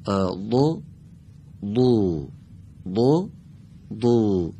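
دو said as a heavy ض with a د colour; دو, a thick ض that leans toward دال; ض with a hint of د. Shown as a demonstration. Teacher demonstrating ض with a hint of دال. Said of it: "زاد رو قرار شد بوی دال هم ازش".

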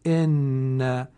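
ان said full, the doubled sound pressed; doubled letter held. Shown as a demonstration. Teacher giving the correct reading of the example, pressed.

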